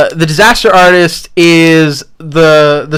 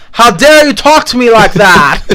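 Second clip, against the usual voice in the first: loud, high, slow mock outrage; hammed-up indignant impression with foreign accent; Tommy Wiseau; 7 semitones higher, 5 dB louder, slower than usual. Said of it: "I was doing my Tommy Wiseau voice".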